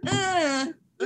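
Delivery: high, drawn-out "uh" noise; Allison noise. Retold or imitated. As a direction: make her little discovery noise. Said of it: "The noise that you make".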